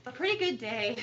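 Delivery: a small laugh breaks into the words. Real, not acted. Board member thanking staff, with a laugh. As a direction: laugh it off lightly.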